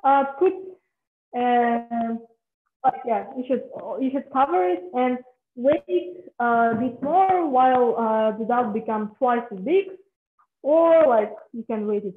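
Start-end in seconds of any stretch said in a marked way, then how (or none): none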